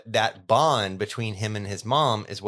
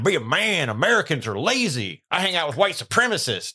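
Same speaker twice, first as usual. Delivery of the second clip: high, swooping mock blowhard; Mike Rowe; 6 semitones higher than usual. Host mocking Mike Rowe's views, swooping scornful impression. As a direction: rant as a hammed-up macho lecturer. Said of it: "not Mike Rowe".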